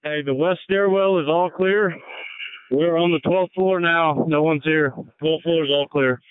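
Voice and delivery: ordinary talk, nothing marked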